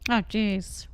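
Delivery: ordinary talk, nothing marked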